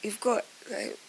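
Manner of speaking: softly imitated polite alert; discreet retold aside. Retells discreetly alerting the man.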